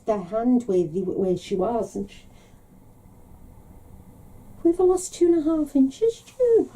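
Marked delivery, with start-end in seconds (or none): 4.6-6.7 much higher, mock indignant old mum; mimicking mum. Mum complains to June about lost height, mock outraged.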